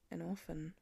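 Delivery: hushed and heavy, barely above a breath; thick whisper. A narrator's reading. Father quietly telling his daughter the boy is alone, hushed and thick. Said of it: "Clint whispered back, voice thick".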